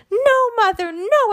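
put-on high, loud child's protest; shouted no. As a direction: cry it out high and loud, horrified. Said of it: "I shouted".

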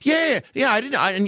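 put-on high, stammering boy; Lou Costello impression. Feigning innocence to his partner, high and boyish. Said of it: "And then Lou says".

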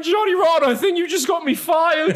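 high, loud panicked yell; panicked shout. Retold or imitated. Host yells at [name] in mock panic, high and loud. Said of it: "And I'm going".

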